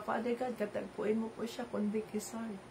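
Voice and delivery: quiet prayer. Acted. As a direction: pray it gently, under your breath almost. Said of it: "magdasal ka, mag-pray ka". (mahinang panalangin)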